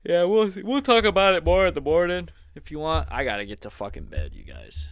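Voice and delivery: drowsy, nodding-off voice; falling asleep. Real, not acted. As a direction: talk while falling asleep. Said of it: "I'm falling asleep".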